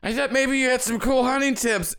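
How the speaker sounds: winded stranger. Acted. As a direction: gasp it out after being knocked back. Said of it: "Their breath is, like, knocked out of them".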